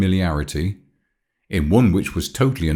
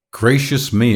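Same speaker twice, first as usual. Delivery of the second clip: higher-pitched startled exclamation; exclaiming; 5 semitones higher than usual. A narrator's reading. Marguerite is shown the ring, exclaiming. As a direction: exclaim in mild shock. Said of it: "exclaimed Marguerite".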